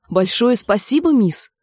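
mildly forced politeness; squeezed-out thanks. A narrator's reading. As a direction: get the thanks out while being dragged off. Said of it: "выдавил Нат".

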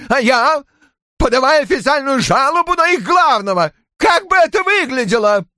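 very high-pitched furious tirade; frantic outcry. A narrator's reading. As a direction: rant wildly in self-defence. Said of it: "бешено жестикулируя, вскричал". (яростно, срываясь на крик)

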